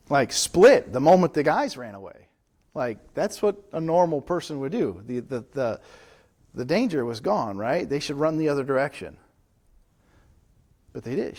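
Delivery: normal talking voice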